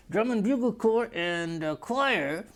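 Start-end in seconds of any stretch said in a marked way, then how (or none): none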